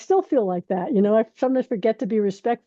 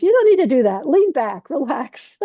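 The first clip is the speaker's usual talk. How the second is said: high, louder mock advice; imitating the teacher; 6 semitones higher, 5 dB louder than usual. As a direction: coax her to lean back, pitched up and a bit loud. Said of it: "He goes".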